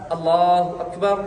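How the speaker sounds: Allahu Akbar said slowly with a rise on Akbar; teacher showing the women's takbir. Shown as a demonstration. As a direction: draw out Akbar, lifting at the end. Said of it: "तकबीर औरतें इधर बांधेंगी ऐसा".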